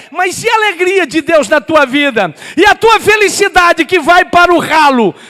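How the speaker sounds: high, loud pulpit challenge; fervent shouted rebuke; preaching at full force. Real, not acted. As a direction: confront the congregation at full voice.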